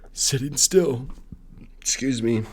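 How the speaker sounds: words pushed out through a burp; burping while talking. Real, not acted. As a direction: say sitting still through a burp. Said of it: "I'm sorry, I keep burping".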